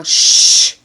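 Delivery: a long unvoiced shh; shushing. Shown as a demonstration. Vlogger tells his racing mind to hush. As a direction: hush the thoughts firmly. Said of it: "I often tell myself".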